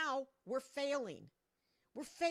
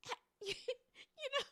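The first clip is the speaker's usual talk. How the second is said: laughing; 12 semitones higher, much slower than usual. Real, not acted. Laughing in disbelief at tariffs.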